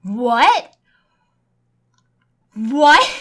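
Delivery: loud rising disbelief; can't believe it, call it out; incredulous what. Real, not acted. Disbelief at phone menu.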